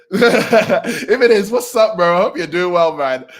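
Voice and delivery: high, loud, laughing hello; laughing greeting. Real, not acted. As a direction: greet a friend through laughter. Laughs while greeting a viewer.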